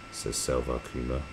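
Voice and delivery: usual speaking voice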